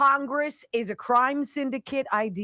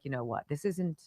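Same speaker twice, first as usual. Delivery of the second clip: much softer, lower self-talk; calming herself; 5 semitones lower, 7 dB quieter than usual. Retold or imitated. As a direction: talk yourself down quietly. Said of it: "until I was like".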